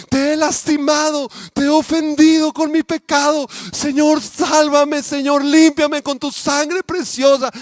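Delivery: high, loud, slow wailing prayer; a boy's sobbing shouted plea for salvation; shouting and weeping. Retold or imitated. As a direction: cry out to God through tears. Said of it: "escuchaban los gritos y el llanto".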